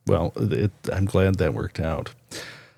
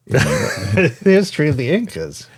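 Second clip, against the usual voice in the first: laughing, higher-pitched repeat; amused disbelief; 5 semitones higher than usual. Real, not acted. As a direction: echo it through a laugh, incredulous. Amused repeat of the absurd prompt.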